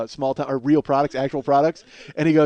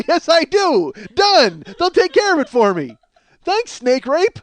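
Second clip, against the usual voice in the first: gleeful mock reply; 13 semitones higher, 9 dB louder, slower than usual. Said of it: "I go".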